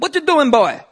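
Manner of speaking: mock Trinidadian scold, high and loud; Trinidadian accent; Trinidadian teacher. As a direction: call out like a stern Trinidad teacher. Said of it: "She said".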